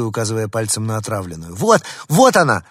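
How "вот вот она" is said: high, loud pointing cry; excited shout. A narrator's reading. Girl points at the rat, shouting loudly. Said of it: "закричала рыженькая".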